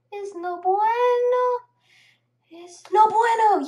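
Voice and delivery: loud, high exclamation; playful shout. Pretend.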